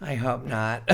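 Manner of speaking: ordinary delivery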